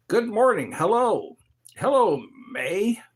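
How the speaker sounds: high, loud cheery hello; excited greeting. Real, not acted. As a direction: greet them with big energy. Greeting viewers loudly.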